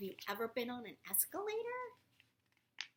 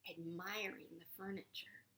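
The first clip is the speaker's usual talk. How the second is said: ordinary delivery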